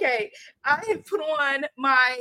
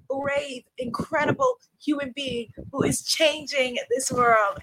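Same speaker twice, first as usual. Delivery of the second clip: ordinary talk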